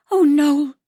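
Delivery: moved almost to tears; tearful. A narrator's reading. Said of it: "tears welling up in her eyes".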